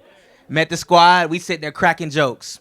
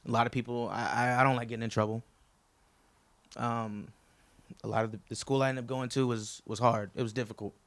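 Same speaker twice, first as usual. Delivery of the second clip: low, softened, halting confession; subdued and searching for words; a little choked up; 5 semitones lower, 7 dB quieter than usual. Real, not acted. Best man on hard college years, subdued and choked up. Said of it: "I'm getting a little choked up".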